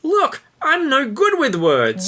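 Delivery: put-on very high, pleading young man. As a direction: beg her to stay, squeaky.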